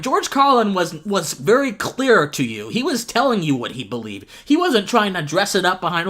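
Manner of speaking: ordinary delivery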